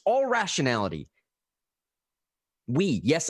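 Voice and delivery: ordinary talk, nothing marked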